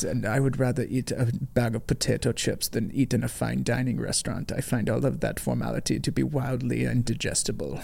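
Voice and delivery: a hint of Herzog's German accent; Werner Herzog German accent. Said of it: "excuse to do a German accent".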